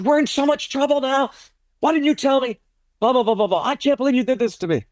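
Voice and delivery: imitating her furious scolding, pitched high and rushed; high, quick retold tirade. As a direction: berate him in a high mock panic. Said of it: "Oh, she's furious".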